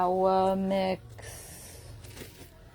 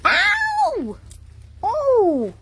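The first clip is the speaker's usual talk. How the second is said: real yelp of pain; much slower than usual. Real, not acted. Bitten by a puppy's needle teeth, real high yelp.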